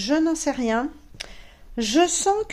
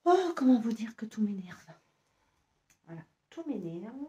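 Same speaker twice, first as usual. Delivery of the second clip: whispered, fed-up sigh of annoyance; exasperated whisper; 4 dB quieter than usual. Real, not acted. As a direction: breathe it out in a whisper, worn out. Fed up with the sewing.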